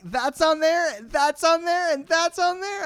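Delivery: retelling his own giddy squeals; squealing excitement. Retold or imitated. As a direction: squeal each find with glee. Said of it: "I was just like".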